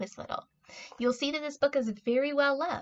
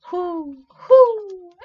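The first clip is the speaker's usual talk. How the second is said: high hooting, swelling much louder; owl hoot; 7 semitones higher, 12 dB louder than usual. A narrator's reading. Owl asking the spider, high swelling hoots. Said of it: "asked the owl".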